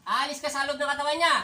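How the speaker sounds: loud, high command to leave the body; stern exorcist's shouted command. Real, not acted. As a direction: order the spirit out with force.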